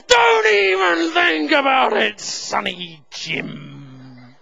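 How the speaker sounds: re-enacted yell that runs out of steam; shouted threat fading away. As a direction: start at a roar, let it wilt slowly.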